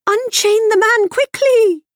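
high, loud horse-like urgency; whinnying mare. A narrator's reading. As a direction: neigh the words out urgently. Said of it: "whinnied the mayor".

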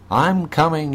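high excited yelp; eager yelp. A narrator's reading. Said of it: "yelped the dog".

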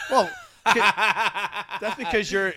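talking through real laughter, high and loud; laughing. Real, not acted. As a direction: tease loudly through a laugh, drawn out. Teasing friend's height while laughing.

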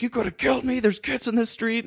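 high-pitched mock scolding; angry driver impression. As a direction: act out an irate driver's reproach. Retold angry driver confronting him.

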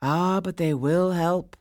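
calm, measured chorus; lightly voiced stone voices. A narrator's reading. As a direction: reassure him solemnly. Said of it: "came the stone voices".

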